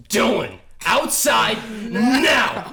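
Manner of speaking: slow, loud teacher shout; teacher's shout. Retold or imitated. Teacher ordering student outside, loud slow shout. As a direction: bark the order like a strict teacher. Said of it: "teacher, just dead eyes, goes".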